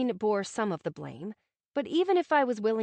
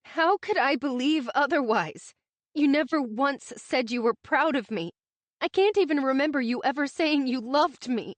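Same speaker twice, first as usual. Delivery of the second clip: sobbing; 4 semitones higher, 6 dB louder than usual. A narrator's reading. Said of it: "I sobbed".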